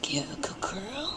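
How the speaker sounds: high whispered praise for a dog. Real, not acted. Praising the puppy.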